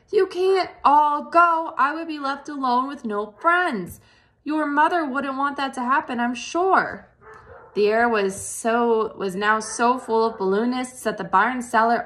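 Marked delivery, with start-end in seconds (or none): 0.1-7.1 pleading. A narrator's reading. Wilbur pleading.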